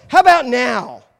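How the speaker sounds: high, loud mock wife voice; imitating his wife. Husband acting out his wife posing in the dressing room, high and loud. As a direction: ham up the wife posing and asking. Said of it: "And she would go".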